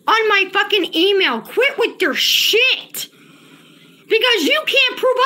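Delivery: high, strained angry yell at a chatter; really shouting, voice cracking with rage; furious real outburst at a troll. Real, not acted. Streamer yelling at chat user.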